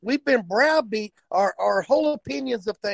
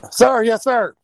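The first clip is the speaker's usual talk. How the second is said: recruit answering; 3 semitones higher, 7 dB louder, slower than usual. Acted. Recruit answering drill sergeant.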